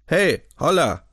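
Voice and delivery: loud, high hail across the street; calling out. A narrator's reading. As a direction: call out to the riders. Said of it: "rief da eine Stimme".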